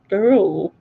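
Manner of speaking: "girl" in a shaky-attempt Scottish accent; badly faked Scottish accent. Shown as a demonstration. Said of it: "I can't do accents".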